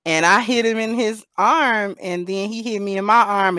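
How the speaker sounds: normal talking voice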